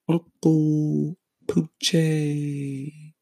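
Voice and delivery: high, very slow drawn-out host name; drawn-out signature name. Acted. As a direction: stretch the name like a show announcer. Podcast host sign-off name.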